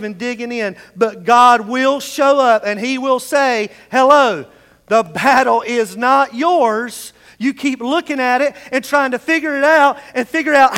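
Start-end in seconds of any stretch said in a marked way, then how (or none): 4.9-7.1 "The battle is not yours" slowly and louder, landing on "yours"; slow it down and hit "yours"; slow emphatic declaration. Acted. God's message preached.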